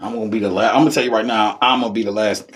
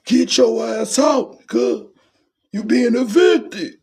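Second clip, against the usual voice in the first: put-on a sloppy drunk throwing people out; hammed-up drunk tirade, much higher; 13 semitones higher than usual. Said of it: "that drunk shit".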